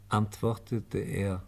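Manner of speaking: ordinary talk, nothing marked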